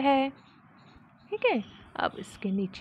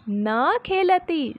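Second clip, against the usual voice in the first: slow model reading; 5 semitones higher, much slower than usual. Shown as a demonstration. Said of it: "teacher models the sentence".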